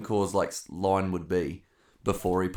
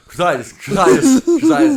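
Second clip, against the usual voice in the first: high, loud mock chanting; excited child fan; 14 dB louder than usual. Kid running around the lounge, excited high chanting. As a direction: cheer it like an overexcited little supporter.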